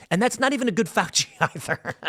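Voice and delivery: breaking into a laugh at the end; amused, self-mocking chuckle through the words. Real, not acted. Laughs at his own impression.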